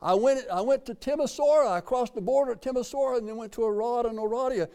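high, eager rush of recognition; lively surprised traveller; excited retelling. Retold or imitated. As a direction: tell it like you can't believe it. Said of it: "I said".

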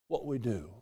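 ordinary delivery

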